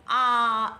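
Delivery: loud open-mouthed आ; a long loud आ; wide open aah. Shown as a demonstration. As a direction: show the mouth opening wide. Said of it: "wide open करते हैं".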